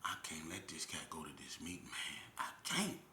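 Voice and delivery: hushed inner voice, barely breathed; whispered thoughts. Retold or imitated. Lying in the cell worrying about the meeting, whispered. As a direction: whisper it like a worried secret thought. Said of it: "The whole time I'm thinking".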